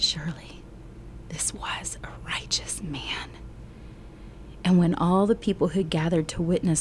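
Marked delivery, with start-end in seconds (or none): 0.0-3.3 softer, breathless whisper of awe; a guard stunned into a hush; awed whisper. Acted. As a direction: centurion whispers his awe at the death.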